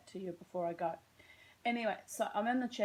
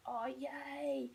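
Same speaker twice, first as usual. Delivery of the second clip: excited; 4 semitones higher, slower than usual. Retold or imitated. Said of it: "I was like".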